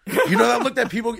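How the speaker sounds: ordinary talk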